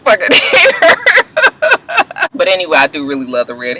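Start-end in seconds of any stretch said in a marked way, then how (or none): 1.0-2.3 a burst of high-pitched laughter. Real, not acted. Vlogger laughing at her own joke about her red hair. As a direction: crack up at your own joke.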